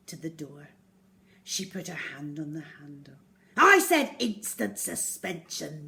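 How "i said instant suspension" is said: loud, furious teacher bellow; yelling teacher. Acted. Miss Midge yells at Zoe, loud and stern. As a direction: yell it down at the class. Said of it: "yelled Miss Midge".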